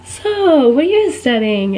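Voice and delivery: high swooping "so" into the question; jokester. Retold or imitated. Jokester opening on a student, swooping teasing question. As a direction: imitate a jokester winding up a gag. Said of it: "that jokester".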